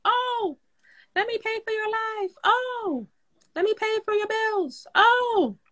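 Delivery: high falsetto impression; high-pitched mocking mimicry; Melissa voice. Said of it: "the boy Melissa voice".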